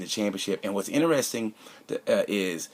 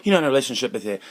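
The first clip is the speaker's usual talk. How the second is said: usual speaking voice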